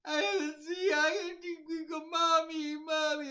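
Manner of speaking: high, loud fake sobbing; hammed-up baby wail for mommy; mock crying. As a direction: whine like a crybaby.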